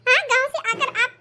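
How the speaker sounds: catty neighbour cackling at the outfit; mocking neighbour Mrs Sharma. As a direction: tease her and laugh at her dress. Neighbour mocks the new dress.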